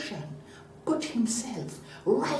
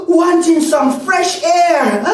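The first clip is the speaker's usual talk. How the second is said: loud, high, slow and desperate; dramatic breathless cry; gasping for breath; 9 semitones higher, 13 dB louder, slower than usual. A narrator's reading. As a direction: cry it out as if starved of air. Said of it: "panting for breath".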